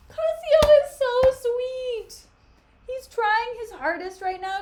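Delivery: squealing fangirl gush about Cassio. Real, not acted. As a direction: swoon over how sweet he is. Gushing over Cassio's kindness.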